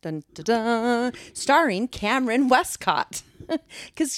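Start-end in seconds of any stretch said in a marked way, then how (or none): 0.0-1.1 dramatic dun dun dun. Acted. Dramatic sting sound.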